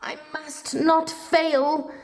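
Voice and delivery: high, resolute little voice; a hint of a brave tiny hero; determined snail. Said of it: "said the tiny snail".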